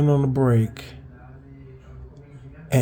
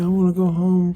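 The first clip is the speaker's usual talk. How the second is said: imitated plaintive plea; 7 semitones higher than usual. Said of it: "laying on the ground crying".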